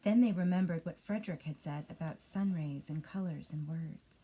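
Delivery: ordinary delivery, nothing marked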